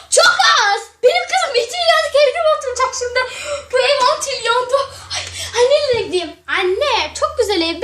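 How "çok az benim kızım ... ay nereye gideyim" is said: high, wailing half-laugh half-cry; hysterical mother. Acted. Mother wails to her daughters about the cheap house, high and tearful. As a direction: shriek like a dramatic mother in despair.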